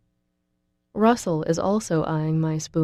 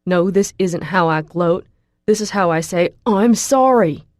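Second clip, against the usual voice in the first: yelled apology; 7 dB louder than usual. A narrator's reading. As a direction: yell the apology, dragging out sorry. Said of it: "he yells back at me".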